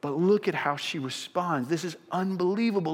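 ordinary delivery

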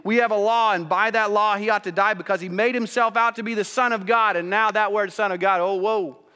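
high, loud and driving preaching; full-force preaching. Acted.

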